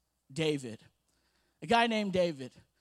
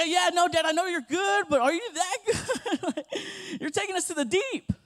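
put-on higher, laughing child's doubt; 8 semitones higher, 4 dB louder than usual. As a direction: tease dad nervously with a laugh. Doubting dad's goodness, child's voice.

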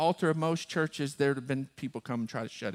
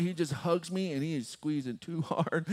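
normal talking voice

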